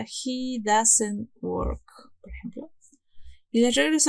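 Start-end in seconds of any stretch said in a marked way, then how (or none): 1.4-2.7 breathy whispered aside. Real, not acted. Teacher explaining third person to students, whispering. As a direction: drop to a whisper mid-explanation.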